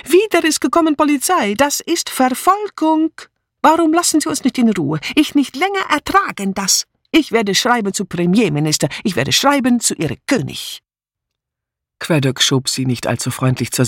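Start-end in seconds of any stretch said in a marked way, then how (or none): 0.0-10.8 high, excited foreign maid in broken German; Mitzi calling out. A narrator's reading. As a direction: cry out in outrage as the flustered foreign maid. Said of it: "und rief". (hoch und empört rufend, gebrochenes Deutsch)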